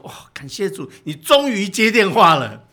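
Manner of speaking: exasperated relief building to loud outburst; retold scolding. Doctor friend finally reaching the father by phone, exasperated scolding. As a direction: scold loudly on finally getting through. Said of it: "他一直骂".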